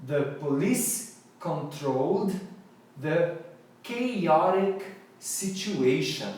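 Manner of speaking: slow, careful English sentence for repeating; slow model reading. Shown as a demonstration. Teacher reads a sentence slowly for students to repeat. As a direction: lay out each word slowly for the class. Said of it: "Vamos falar comigo".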